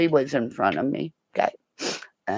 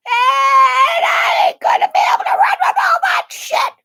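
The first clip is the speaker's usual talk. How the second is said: very high, loud wail; exasperated shout; 6 dB louder than usual. Retold or imitated. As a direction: yell it in comic protest. Shouted repeat about herself in gear.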